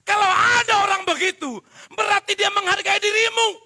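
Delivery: high, loud preaching cry; full-voice shout. Acted. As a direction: cry the point out to the whole church. (seruan khotbah yang keras dan tinggi)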